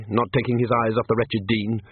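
ordinary delivery, nothing marked

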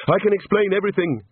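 high-pitched pleading protest; hasty excuse. A narrator's reading. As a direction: plead high to get out of trouble. Rincewind pleads with watchmen.

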